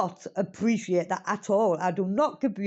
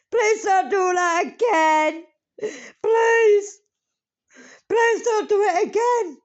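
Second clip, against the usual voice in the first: real crying, louder and higher, slowed by sobs; tearful pleading; 9 semitones higher, 5 dB louder, slower than usual. Real, not acted. Crying to her late cousin Lisa, begging her to stop touching, tearful plea. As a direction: beg through tears, voice cracking high.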